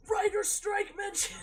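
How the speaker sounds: thrilled to catch the reference; excited gasp. Real, not acted. Streamer catches a writers strike joke, excited shout.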